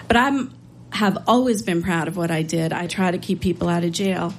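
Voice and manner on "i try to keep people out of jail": ordinary delivery, nothing marked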